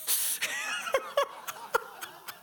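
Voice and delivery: breathy high laugh at his own punchline; real laugh. Real, not acted. Pastor laughing after the John effect line, breathy laugh. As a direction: let the laugh burst out.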